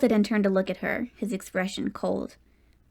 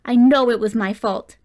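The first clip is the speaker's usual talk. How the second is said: anguished exclaim; 8 dB louder than usual. A narrator's reading. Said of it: "he suddenly exclaimed".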